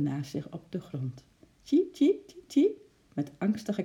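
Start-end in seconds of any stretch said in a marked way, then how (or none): 1.6-2.8 very high repeated cheeps; squeaking squirrel. A narrator's reading. Squirrel looking round new cage anxiously, squeaking. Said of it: "piepte hij zachtjes".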